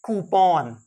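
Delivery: कूपन said "KOO-pawn", loud; long open "aw" in the end of कूपन; correct pronunciation. Shown as a demonstration. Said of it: "ये कूपन नहीं है". (कूपन का अंत लंबा "पॉन")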